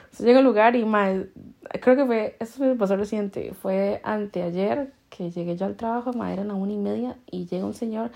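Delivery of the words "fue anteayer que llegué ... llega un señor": ordinary delivery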